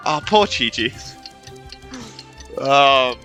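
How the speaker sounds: ordinary talk, nothing marked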